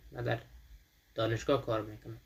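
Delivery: ordinary delivery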